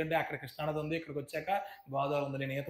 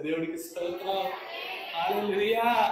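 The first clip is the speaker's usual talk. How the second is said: a rising, louder cry of praise; praise shout; 4 semitones higher, 4 dB louder than usual. Real, not acted. Preacher shouting praise to the church, loud and joyful. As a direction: throw out hallelujah to the congregation.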